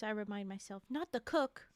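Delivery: ordinary delivery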